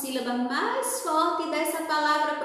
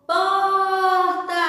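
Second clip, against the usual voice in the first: loud, higher sung-out call, first syllable held long; demonstrative shout; 4 semitones higher, 6 dB louder than usual. Shown as a demonstration. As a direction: call it out as if across a distance. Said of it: "Vou gritar junto com você agora a palavra porta". (gritando a palavra, sílaba tônica prolongada)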